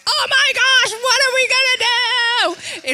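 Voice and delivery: very high, loud panicked wail; hammed-up inner freak-out at full volume; panicked outburst. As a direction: shriek it in comic panic. Retelling her panicked inner reaction.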